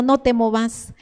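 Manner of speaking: ordinary delivery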